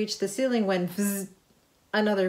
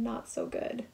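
ordinary delivery, nothing marked

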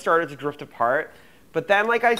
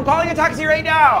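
panicked; 9 semitones higher, 5 dB louder than usual. Acted. Panic, brother's asthma attack.

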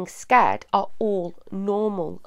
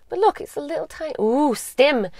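delighted ooh; 6 semitones higher than usual. Real, not acted. Delighted by reflection on paint.

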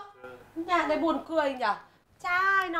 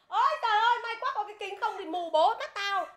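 mock yell; 6 semitones higher than usual. Telling the punchline of the blind beggar joke, mock yell. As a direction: ham up the beggar's shout. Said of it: "nó hét lên".

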